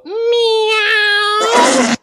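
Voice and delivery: long high loud cat meow. Pretend. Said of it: "just go".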